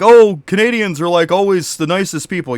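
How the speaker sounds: high, loud sarcastic mimicry of what people say; mock the cliché, sing-song and scornful; mocking quote. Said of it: "for people to be like".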